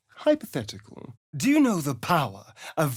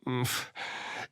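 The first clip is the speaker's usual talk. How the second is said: soft sigh; 10 dB quieter than usual. Acted. Tag alone, soft sigh.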